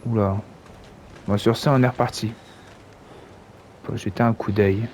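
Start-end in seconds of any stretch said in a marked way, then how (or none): none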